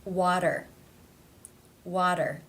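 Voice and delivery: "Water" with the T tapped like a D; flap T. Shown as a demonstration. Teacher showing a T between two vowels, flapped in "Water". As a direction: tap the middle T of "Water" into a quick D sound. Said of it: "pronounced as the flap tap T".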